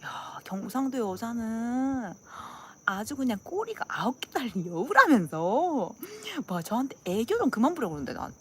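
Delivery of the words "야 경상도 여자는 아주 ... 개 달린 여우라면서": slow, drawn-out insinuating tease; Seoul friends teasing. Retold or imitated. Seoul friends to Gyeongsang girl, slow teasing. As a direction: stretch each phrase with mock wonder. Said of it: "서울 애들이 저보고".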